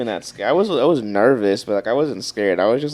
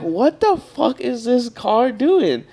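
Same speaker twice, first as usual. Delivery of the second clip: very slow, higher, stretched out incredulous question; drawn-out disbelief; 10 semitones higher, much slower than usual. Retold or imitated. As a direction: drag every word out in disbelief. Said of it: "I'm like".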